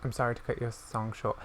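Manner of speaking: usual speaking voice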